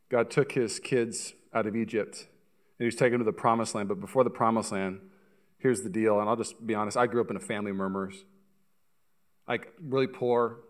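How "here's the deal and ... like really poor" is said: ordinary delivery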